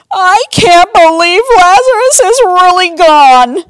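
high, loud hammed-up wail; crying. A narrator's reading. Sister at brother's bed, hammed-up sobbing. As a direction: wail it like a storybook sob. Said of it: "Mary cried".

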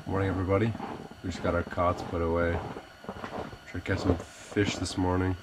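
ordinary delivery

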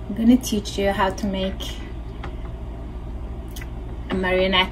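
ordinary talk

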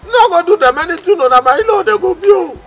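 high, loud plea; worried high-pitched wail; alarmed pleading. Acted. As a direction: call out begging him to hold back. Calling after friend to spare in-laws.

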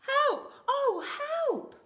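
very high, loud chick cry for help; squeaked panic. Acted. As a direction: squeal out in acted alarm. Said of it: "he squeaked".

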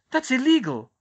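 aghast, high and loud; shocked gasp. A narrator's reading. Hermione protesting Umbridge's curse on Luna, aghast. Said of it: "Hermione gasped".

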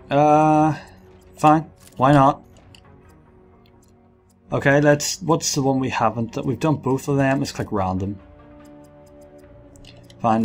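ordinary talk, nothing marked

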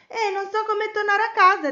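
high, loud fretting; worried. A narrator's reading. Betty cannot find the way home, worried. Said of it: "Betty era preoccupata".

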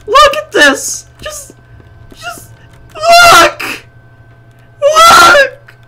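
high, hammed-up tearful wail; mock grief. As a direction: cry over the loss like a melodrama. Mock mourning of game bird.

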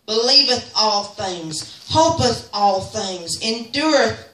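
measured, very slow recitation; slow scripture reading. Real, not acted. Preacher reading First Corinthians to the church, slow and solemn. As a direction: read each line of the verse with weight.

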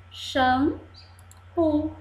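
"Shampoo" split into xam, pu; syllable by syllable. Shown as a demonstration. Teacher shows the little pieces, "Shampoo" cut in two. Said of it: "Vamos ver os pedacinhos?".

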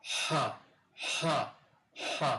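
"হা" as a separate English h sound, repeated; h sound demo. Shown as a demonstration. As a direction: breathe out each "হা" on its own. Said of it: "যখন এটা আমার sound হবে". ("হা" হ ধ্বনি হিসেবে আলাদা আলাদা করে)

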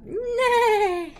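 loud, high, drawn-out whinny; horse neigh. Shown as a demonstration. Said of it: "what a horse says".

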